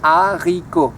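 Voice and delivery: haricot said with silent h and no final t, ah-ree-ko; teacher modelling haricot; French haricot. Shown as a demonstration. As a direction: model haricot slowly for learners to repeat. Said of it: "Can you repeat at, at home?".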